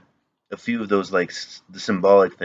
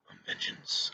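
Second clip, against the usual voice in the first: whispered, very soft superhero imitation; Batman; 16 dB quieter than usual. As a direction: whisper it like a menacing masked vigilante. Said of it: "heard him talk as Batman".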